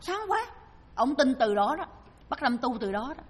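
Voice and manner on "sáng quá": high, drawn-out exclamation of amazement; stretched, high-pitched wonder, rising as it repeats; awed crying out. Retold or imitated. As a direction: imitate an uncle crying out at a dazzling sight. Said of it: "Thì chú la lên".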